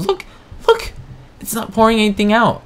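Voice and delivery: annoyed that the pipe pours nothing, high and incredulous; baffled complaint. Real, not acted. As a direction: squeak it out in frustration. Pipe isn't working.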